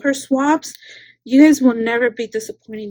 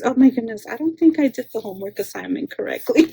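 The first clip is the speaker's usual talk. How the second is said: usual speaking voice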